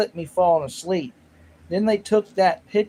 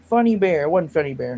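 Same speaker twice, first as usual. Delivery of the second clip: ordinary talk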